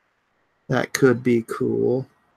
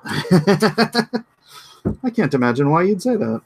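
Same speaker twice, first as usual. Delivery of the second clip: slow, laughing mock innocence; laughing sarcasm; 6 semitones higher, much slower than usual. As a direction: play innocent while chuckling.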